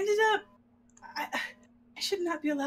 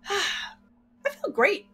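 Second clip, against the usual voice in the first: mock relief; 9 semitones higher, slower than usual. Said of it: "I'm walking back and forth going".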